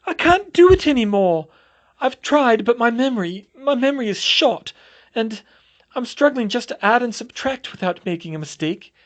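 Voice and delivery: high, loud, tearful wail; a ruined teacher wailing to Hermione; distraught. Acted. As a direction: pour out despair through tears. Distraught teacher continues after yelling.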